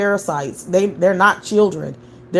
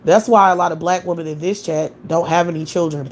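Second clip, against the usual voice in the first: ordinary talk, nothing marked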